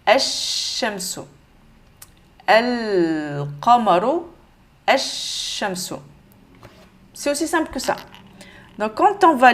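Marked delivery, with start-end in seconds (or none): 0.1-1.2 "ash-shamsu" with the L silent and a long held SH; slow "ash-shamsu", doubled SH drawn out; lam assimilé. Shown as a demonstration. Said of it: "on l'entend plus". 2.5-4.2 slow "Al-qamaru" with a long, clearly sounded L; lam prononcé. Shown as a demonstration. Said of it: "vous allez le prononcer normal". 4.9-5.9 "ash-shamsu" with the L silent and a long held SH; slow "ash-shamsu", doubled SH drawn out; lam assimilé. Shown as a demonstration. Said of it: "on l'entend plus".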